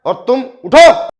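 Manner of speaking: loud, high, dragged-out order to wake up; husband shouting his sleeping wife awake, loud and angry. Acted. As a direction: bellow at her to get up. Husband wakes wife, shouts accusation.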